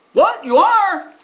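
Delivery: high, loud what, you are; stunned, can't believe it; stunned disbelief. Retold or imitated. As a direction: groom hearing the plan, high and stunned. Groom stunned by the claim.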